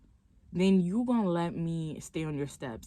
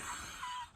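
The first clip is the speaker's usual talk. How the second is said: short high-pitched laugh; quick squeaky giggle; 14 dB quieter than usual. Real, not acted. Laughing at the late doorbell.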